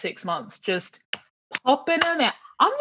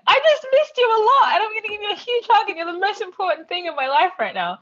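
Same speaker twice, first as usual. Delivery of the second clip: put-on much higher and louder, breathless little kid; gushing child; 13 semitones higher, 3 dB louder than usual. Friend imitating a child's unabashed love, high gushing kid. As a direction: rush it out like an adoring child. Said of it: "that's like".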